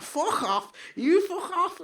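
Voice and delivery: imitating her taunting her opponent, pitched high and laughing; mock fighter's taunt. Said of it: "She's like".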